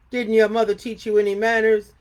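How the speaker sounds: loud scolding bear voice; stern Papa Bear. A narrator's reading. Papa Bear scolding the intruding girl, loud. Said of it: "said Papa Bear".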